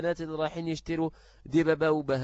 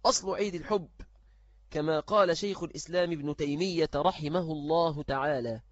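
normal talking voice

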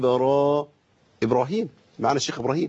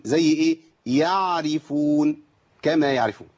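normal talking voice

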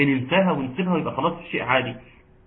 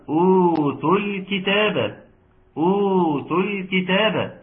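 higher, slow chanted recitation; Quran recitation; 5 semitones higher, slower than usual. Shown as a demonstration. Teacher recites for students to judge, higher slow recitation. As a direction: chant it slowly at a raised pitch.

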